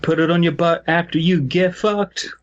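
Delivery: usual speaking voice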